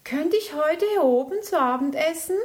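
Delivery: high, loud, fast hopeful question; bright cub begging quickly; eager little bear. Acted. Little bear asks to eat up there, eager plea.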